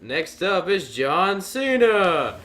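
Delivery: high, loud, slow arena announcer; booming wrestling introduction; ring announcer. Retold or imitated. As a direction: draw the name out for the crowd. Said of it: "the announcer said".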